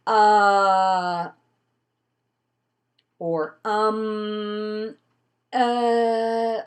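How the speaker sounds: long loud drawn-out uh; mock nervous speaker's uh; filled pause demo. Shown as a demonstration. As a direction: drone a nervous filler sound. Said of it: "the first sound you hear is".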